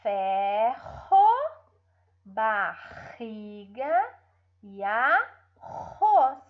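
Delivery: "Ferro, barriga e arroz" slowly, with throaty R; guttural H-like R in "Ferro, barriga e arroz"; strong R. Shown as a demonstration. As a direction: stretch each syllable of "Ferro, barriga e arroz", rasping the RR. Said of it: "vamos ler os fortes".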